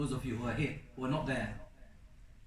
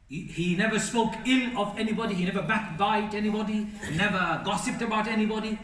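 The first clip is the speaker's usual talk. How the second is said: louder, higher preaching run, each clause driven home; preaching with force; 5 semitones higher, 5 dB louder than usual. Acted. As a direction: build the list like a sermon climax. Raises voice listing virtues.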